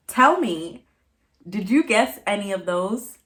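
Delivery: ordinary talk, nothing marked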